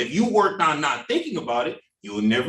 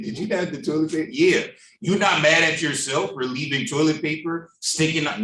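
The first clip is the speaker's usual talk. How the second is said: ordinary delivery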